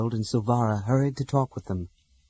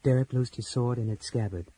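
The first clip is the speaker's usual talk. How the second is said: ordinary delivery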